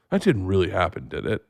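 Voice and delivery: low, soft sceptical question; doubting dad. Retold or imitated. Imitating dad questioning his son's story, low and doubtful.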